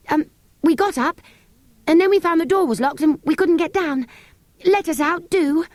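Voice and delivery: put-on high-pitched pleading child character; storybook child explaining and begging, high voice; pleading Cyril. A narrator's reading. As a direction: plead to be let out, pitched well up.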